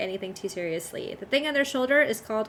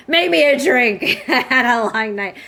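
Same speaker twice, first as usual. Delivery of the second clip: a lightly acted thirsty adventurer, a bit louder; hammed-up tavern patron; 8 dB louder than usual. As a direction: ask for the drink with a mock-weary laugh. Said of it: "That's part of my voice acting".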